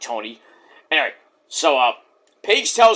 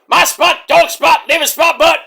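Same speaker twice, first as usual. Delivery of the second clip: high, loud punched-out yell; mock shouting; 10 semitones higher, 6 dB louder than usual. Host riffing on Kenny claiming my spot, mock shouting. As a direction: shout each phrase in a rapid comic burst.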